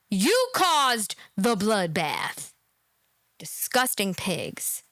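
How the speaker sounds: slow, low contempt; disgusted. Real, not acted. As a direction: spit it out slowly with disgust.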